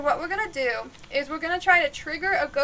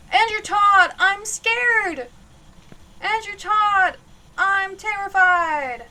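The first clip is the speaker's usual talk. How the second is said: louder and higher, slow, building to a shout; yelling at the ghost; 5 semitones higher, 8 dB louder, much slower than usual. Real, not acted. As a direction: taunt the ghost at full volume, building. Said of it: "by yelling the guy's name out".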